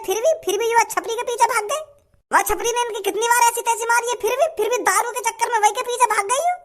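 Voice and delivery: high-pitched, exasperated griping; indignant complaint. Acted. Friend griping to Bhajji about ungrateful villagers, indignant.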